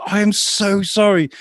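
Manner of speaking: high, drawn-out retold apology; mortified apology. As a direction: plead slowly, high-pitched, deeply embarrassed.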